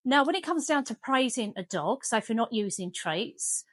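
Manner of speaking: normal talking voice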